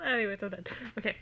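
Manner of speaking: ordinary delivery, nothing marked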